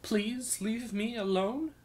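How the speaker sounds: slow, rising, puzzled repeat; puzzled echo. Acted. Minstrel repeating the thief's mutter, puzzled and rising. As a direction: echo the words as if baffled. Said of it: "Tim Tom paused".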